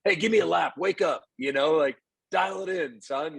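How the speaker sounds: mock the drill-sergeant coach he used to be; harsh old-school coach. Coach telling a struggling shortstop to take a lap, mock harsh bark. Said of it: "in the past I would have just been like".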